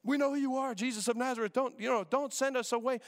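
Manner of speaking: mock the demons begging Jesus not to send them away; panicked demons. Pastor voicing demons begging Jesus, pleading. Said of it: "They're like".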